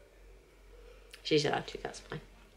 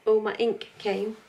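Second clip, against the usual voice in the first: ordinary talk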